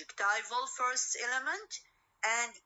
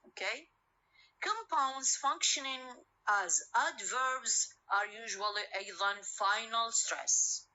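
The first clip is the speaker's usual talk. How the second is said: ordinary talk, nothing marked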